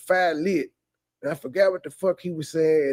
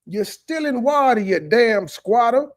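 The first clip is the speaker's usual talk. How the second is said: mock scolding; 7 semitones higher than usual. Real, not acted. Mocking him as a squatter.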